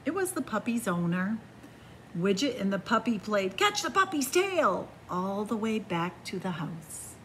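ordinary delivery, nothing marked

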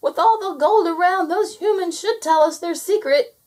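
high, loud gnome voice; chattering gnome. A narrator's reading. Gnome admiring the painted gold, chattering. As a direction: pipe it out loudly, as if chattering. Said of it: "chattered the gnome".

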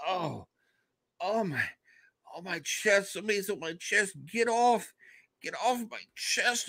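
high, soft, strained reenacted pain; pained groaning. Patient waking with someone on his chest, acted pained pleading. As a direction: act out a crushing weight on the chest. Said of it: "started feeling the pain".

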